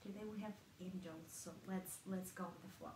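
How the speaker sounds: soft, hushed prayer to begin a meditation; meditative invocation. Acted. Healer opening a group meditation with an invocation, soft and hushed. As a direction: ease into a quiet, reverent invocation.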